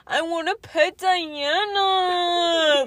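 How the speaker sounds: put-on high, loud, very slow toddler whine; little kid voice. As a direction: drag it out like a begging four-year-old. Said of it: "He'll be like".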